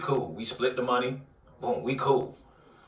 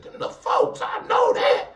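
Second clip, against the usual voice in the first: startled shout; 5 dB louder, slower than usual. Retold or imitated. To himself seeing the car in the driveway, a sudden shout. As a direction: whisper then sudden yelled realization.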